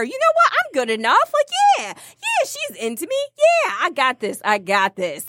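high-pitched hyped pep talk; psyching himself up. Acted. Man psyching himself up before the date, hyped. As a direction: talk yourself up, pitch jumping.

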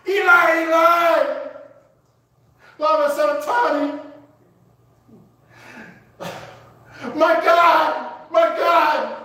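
high, loud cry of desolation; anguished shriek. Acted. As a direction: cry out in agony to a God who left. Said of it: "a shriek of emotional pain".

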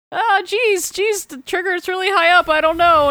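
put-on a scared kid on a high ledge; the trainee stuntman hesitating on the roof, nervous. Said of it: "he's like".

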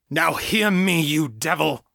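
high booming command; thundered. A narrator's reading. John commanding the demon in Janet, booming. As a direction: thunder it at the devil. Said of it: "John thundered".

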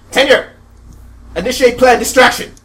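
loud, high villain command; shouted command. Acted. Villain orders his robot to distract them, shouting. Villain triggers escape plan.

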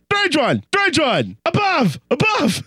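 high, falling mock hollers; mock yell. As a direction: imitate an elder crying out. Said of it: "he doesn't yell that".

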